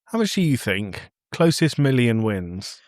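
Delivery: normal talking voice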